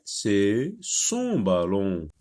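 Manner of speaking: « son » slow and stressed. Shown as a demonstration. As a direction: drag out « son ballon ». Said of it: "C'est le ballon de Farid". (« son » lent et appuyé)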